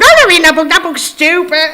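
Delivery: loud, high, bratty refusal; hammed-up sulky teen shouting back; stroppy kid refusing to read, loud and high; mock teenage tantrum. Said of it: "threw a wobbler".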